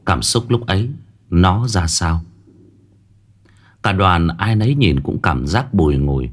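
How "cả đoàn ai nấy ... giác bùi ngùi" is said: plain reading voice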